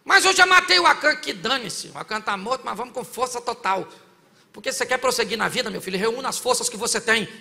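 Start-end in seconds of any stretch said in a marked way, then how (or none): 0.0-3.9 loud, higher bold challenge; lightly voiced defiant Joshua. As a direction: fire it back with full force. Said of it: "Ele falou".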